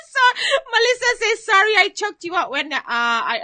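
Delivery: reading through laughter; laughing over a viewer's comment; laughing while reading. Real, not acted. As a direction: keep laughing as you read.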